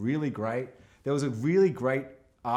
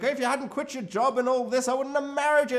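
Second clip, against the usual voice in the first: much higher, mock-nagging wife; 11 semitones higher than usual. Wife nagging her husband about his job, pitched high. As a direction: scold him like a fed-up wife. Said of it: "she's, like, nagging".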